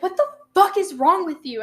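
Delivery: loud, high, mock-furious demand; imitating a girlfriend snapping at her; angry confrontation.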